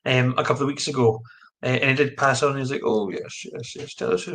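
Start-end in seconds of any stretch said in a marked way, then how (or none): 2.8-4.2 a hint of an eager gentleman, dropping to a whisper; badly imitated murmured assent; bad Adrian Shine impression. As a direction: lightly imitate him, half-voiced. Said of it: "That was a really, really bad impression of Adrian Shine".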